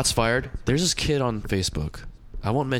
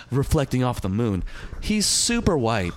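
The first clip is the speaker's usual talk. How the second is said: usual speaking voice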